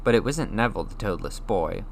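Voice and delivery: ordinary delivery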